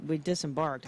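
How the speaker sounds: ordinary talk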